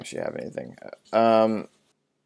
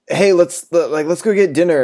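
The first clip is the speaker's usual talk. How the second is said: mock eager kid voice; 7 semitones higher, 4 dB louder than usual. Mocking the nerdy friend's voice.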